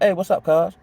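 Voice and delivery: easy, casual hello with no edge; friendly greeting. Shown as a demonstration. Homeboy just saying hello on the block, relaxed and friendly. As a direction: greet him like an old friend, no threat. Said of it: "Oh, he's speaking".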